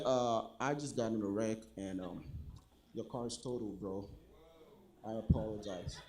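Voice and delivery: very low, soft confession; hushed, sheepish low mumble. Acted. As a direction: break bad news deep and quiet, haltingly. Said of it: "someone starts with a low voice".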